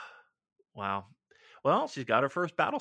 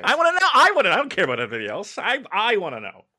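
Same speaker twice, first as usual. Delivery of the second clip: fast, laughing, higher; laughing eagerly; 8 semitones higher, 9 dB louder, faster than usual. Real, not acted. Cohost demanding to hear more, laughing. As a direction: burst in with amused eagerness.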